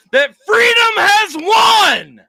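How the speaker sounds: loud, high, slow victory shout; triumphant shout. Retold or imitated. Father shouting victory news to his daughter across the hall.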